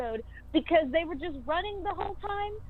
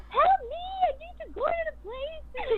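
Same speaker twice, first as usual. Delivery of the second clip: mock panicked cry, pitched way up; hammed-up call for help from the street; high, half-comic desperate yelp; frantic plea. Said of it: "you're like".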